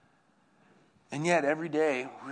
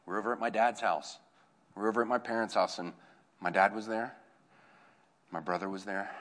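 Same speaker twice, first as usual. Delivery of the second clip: normal talking voice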